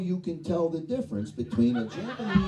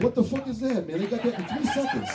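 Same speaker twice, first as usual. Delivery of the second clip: incredulous nephews; 7 semitones higher, faster than usual. Retold or imitated. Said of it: "Me and all my nephews are like".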